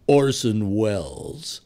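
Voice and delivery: very slow, grand name announcement; Orson Welles. Retold or imitated. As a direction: drop low and stretch the name out. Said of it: "you would hear somebody say".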